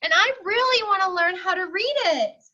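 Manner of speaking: put-on really mean it, like a small boy; earnest wish. Rufus states his wish.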